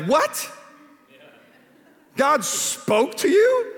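frustrated disbelief. Retold or imitated. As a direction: imitate a baffled younger self, stretching the shocked question. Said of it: "I was so frustrated when I was younger".

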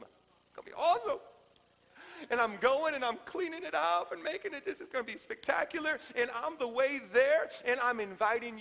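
high, thrilled voice as Jesus; excited promise. Acted. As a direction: gush about the place being prepared.

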